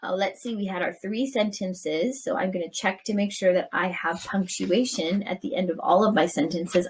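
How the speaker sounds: normal talking voice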